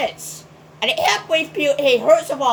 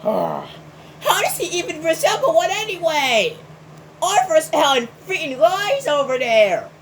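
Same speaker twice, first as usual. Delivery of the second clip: ordinary talk, nothing marked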